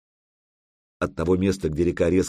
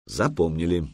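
ordinary delivery

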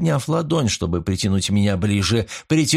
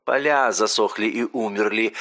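ordinary delivery

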